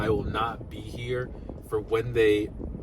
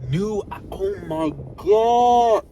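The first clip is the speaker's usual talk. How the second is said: louder and higher, drawn-out slowly; overjoyed exclaim; 8 semitones higher, 7 dB louder, much slower than usual. Real, not acted. Vlogger gushes to viewers over the MacBook features, exclaiming slowly. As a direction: let the oh my God swell and hang.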